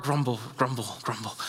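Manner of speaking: sulky muttered grumbling; mock grumbling. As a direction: mutter it under the breath like a sulking churchgoer. Mocking grumble after complaint.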